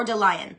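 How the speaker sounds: DeLion said dih-LIE-un, like the animal; pronunciation attempt. Shown as a demonstration. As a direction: say DeLion with "lion" in it. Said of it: "I tried to cover all three".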